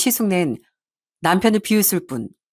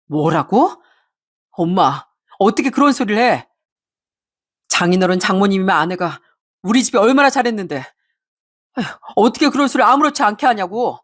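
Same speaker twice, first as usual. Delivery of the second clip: angry husband. A narrator's reading. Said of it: "불같이 화를 낸 건".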